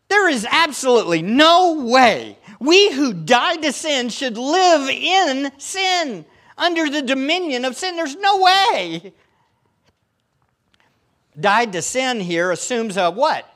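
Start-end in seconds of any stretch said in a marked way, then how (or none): 0.1-9.1 high and loud, slowing on the key words; fervent preaching. Real, not acted. Preacher pressing the congregation, passionate and emphatic. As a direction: drive it home with mounting conviction.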